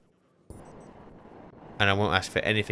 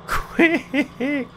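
very high, long, broken squeal; panicked squeal; 4 dB louder than usual. Real, not acted. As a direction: squeal it in stuttering pulses. Screaming as damage mounts.

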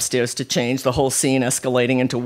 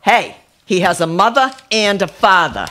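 loud, high protesting refrain; Connie; 7 semitones higher, 6 dB louder than usual. A narrator's reading. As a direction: holler the well-worn family complaint. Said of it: "Echoed by Connie's favorite refrain".

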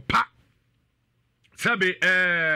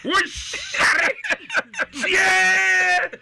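loud high shout of "Tie!"; 12 semitones higher, 6 dB louder, much slower than usual. Real, not acted. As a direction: yell it out, cutting someone off. Shouting at caller.

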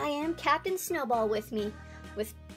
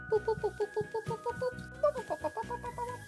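high boops fading softer; alien beeping; 9 semitones higher, 5 dB quieter, much faster than usual. Pretend. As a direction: beep away like a tiny alien.

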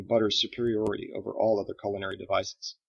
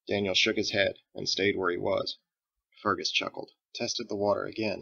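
ordinary delivery

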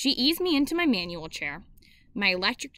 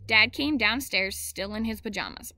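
ordinary delivery, nothing marked

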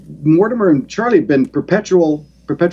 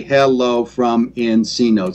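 slow, syllable-by-syllable "hello from Encino"; broken into syllables; 2 semitones lower, slower than usual. Shown as a demonstration. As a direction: break "hello from Encino" into six separate slow beats. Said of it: "if you break down the word".

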